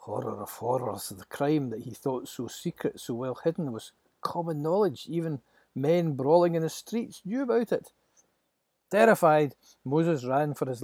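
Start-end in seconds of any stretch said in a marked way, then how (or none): none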